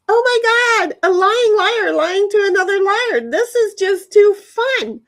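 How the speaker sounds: high, loud mock-astonished outburst; gleeful disbelief. Acting out her thought on a lying liar, gleeful. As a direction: relive the delight in a high, excited cry.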